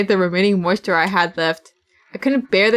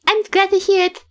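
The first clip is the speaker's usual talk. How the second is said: higher sweet polite reply; lightly voiced girl; 10 semitones higher than usual. Voicing Yua's reply to the nod, high and sweet. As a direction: chirp it like a doll.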